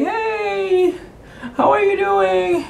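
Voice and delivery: very high, drawn-out singsong hello; high-pitched, innocent-sounding hey; put-on casual greeting. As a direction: squeak out an overly casual greeting, stretching it. Fake casual greeting after oh crap.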